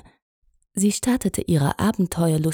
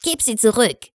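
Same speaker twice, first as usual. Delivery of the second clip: higher snarling demand; 6 semitones higher than usual. A narrator's reading. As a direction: snap it back at him. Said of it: "schnarrte Mullet".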